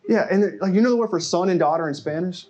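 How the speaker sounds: usual speaking voice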